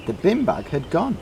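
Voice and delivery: usual speaking voice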